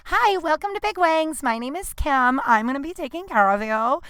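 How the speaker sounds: high, put-on hostess-style welcome; scripted server greeting. Server introducing herself to diners, mock perky scripted greeting. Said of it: "got written up a lot for not being like".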